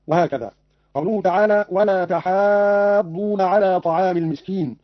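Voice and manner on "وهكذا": normal talking voice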